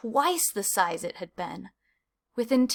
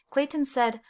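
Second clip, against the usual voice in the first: usual speaking voice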